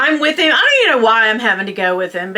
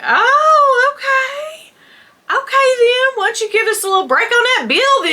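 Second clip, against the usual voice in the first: hammed-up haggling in a pitched-up voice; mock sassy reaction; 13 semitones higher than usual. Wife joking to the clerk about the bill, mock sassy.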